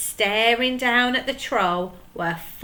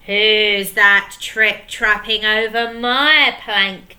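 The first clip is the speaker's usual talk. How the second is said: slow, louder mocking troll voice; jeering; 8 dB louder, slower than usual. Handkerchief jeering at the troll on the plank, mocking. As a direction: taunt him in a gruff put-on voice. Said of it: "jeered Handkerchief".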